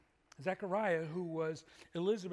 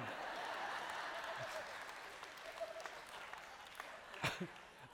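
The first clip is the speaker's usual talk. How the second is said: laugh; 7 dB quieter than usual. Real, not acted. Laughs at own TV story.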